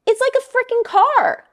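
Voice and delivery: real disbelief at the bank's profit; exasperated outburst. Real, not acted. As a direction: let the disbelief burst out. Outrage at bank profit.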